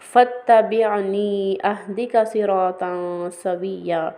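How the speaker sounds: slow chanted Arabic recitation of a verse; Quranic recitation. Shown as a demonstration. Teacher recites the verse to the class, slow chanted recitation. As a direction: intone it slowly like tilawat.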